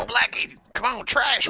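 high-pitched hollered self-introduction, imitating a friend; whooping party-animal impression; Blackie. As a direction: mimic a rowdy guy shouting his own name. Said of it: "introduces himself to everybody as".

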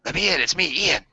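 brother at the window. Retold or imitated. Brother tapping on outside window at night, imitated urgent pleading. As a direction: plead to be let in, like a spooky visitor. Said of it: "My brother tapping on the outside window".

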